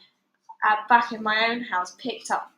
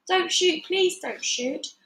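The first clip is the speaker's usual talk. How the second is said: pleading; 7 semitones higher, slower than usual. A narrator's reading. Couple begging armed ducks.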